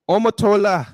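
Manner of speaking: put-on "Omotola" loud and high in an African accent; African accent name. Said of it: "we will do it in the African accent".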